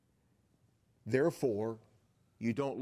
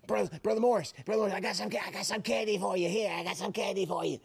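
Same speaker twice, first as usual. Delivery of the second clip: put-on pitched up, eager kid voice pushing candy; excited teen kid; 8 semitones higher, faster than usual. Teen kid urging the pastor to take candy, higher and eager. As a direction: play the pesky thirteen-year-old offering a treat.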